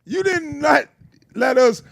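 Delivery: high, deliberate, pointed complaint; indignant scolding, word by word; exasperated disbelief. Real, not acted. Mock outrage at trailer spelling it out.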